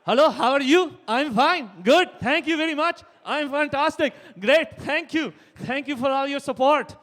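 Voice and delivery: put-on loud, high Indian accent with the head bobbing; loud, high Indian prime minister greeting the crowd; head-wobbling Indian accent. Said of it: "moving his head when he's talking".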